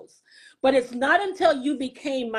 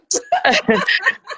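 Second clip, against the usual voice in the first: a high, loud, embarrassed laugh through tears; a release laugh, high and loud; real laugh; 7 semitones higher, 5 dB louder than usual. Real, not acted. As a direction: laugh it off to get yourself together. Collecting herself after tears.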